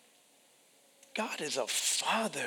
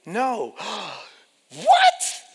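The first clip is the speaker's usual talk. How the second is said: astonished parent; 7 semitones higher, 10 dB louder than usual. Acted. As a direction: gasp then a very high loud what.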